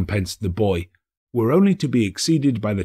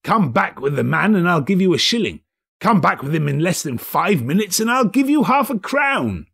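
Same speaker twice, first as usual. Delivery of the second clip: excited Scrooge; 8 semitones higher than usual. Acted. Scrooge excitedly sends boy off.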